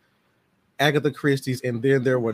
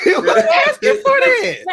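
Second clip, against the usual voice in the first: high, loud laughing jab; squealing through laughter; laughing tease; 7 dB louder than usual. Real, not acted. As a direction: crack up while saying it. Laughing teasing of friend.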